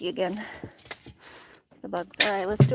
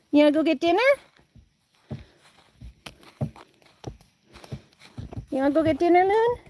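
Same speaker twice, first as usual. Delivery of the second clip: high, louder cooing pet talk; 6 semitones higher, 5 dB louder than usual. Real, not acted. Owner cooing at hen Looney in high baby talk. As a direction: coo at the hen lovingly.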